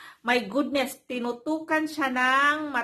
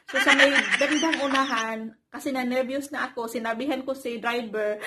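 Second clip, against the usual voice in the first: usual speaking voice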